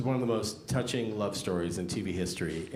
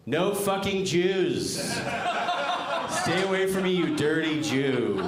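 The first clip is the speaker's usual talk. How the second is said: mock hateful shout; 8 semitones higher, 3 dB louder than usual. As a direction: spit it out like a bigot. What the dating profile "really says.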